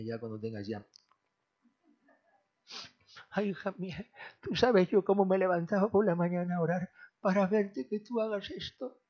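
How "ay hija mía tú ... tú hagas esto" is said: lightly voiced mother, a little higher, sighing Ay; tender mother. Mother tells her daughter she prayed for this, lightly voiced and tender. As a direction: speak as the proud mother thanking her daughter. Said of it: "Y dice su madre".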